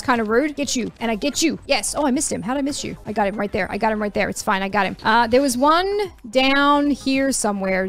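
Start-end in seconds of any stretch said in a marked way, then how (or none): none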